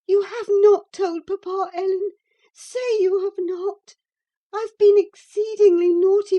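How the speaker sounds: high, loud tearful begging; pleading crying girl. A narrator's reading. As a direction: beg desperately, voice near breaking. Girl begs nurse not to tell.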